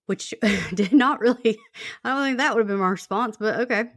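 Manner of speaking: amused disbelief, laughing through the words. Real, not acted. Host laughing at the caller's odd reply, quick and amused. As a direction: chuckle while questioning the caller's answer.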